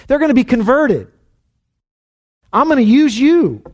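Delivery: high, emphatic declarations; emphatic promise. Retold or imitated. Preacher voicing the Lord's promise to the church, emphatic.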